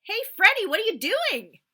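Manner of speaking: very loud, high startled yell; dad yelling at son who fell in. Acted. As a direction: shout across the lake. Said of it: "my dad yelled".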